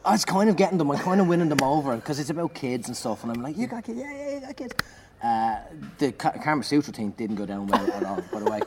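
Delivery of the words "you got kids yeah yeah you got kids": very high, squeaky enthusiasm; imitated eager crowd work. Comedian winning the crowd over with material about kids, high eager voice. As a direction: seize on it in a high excited voice.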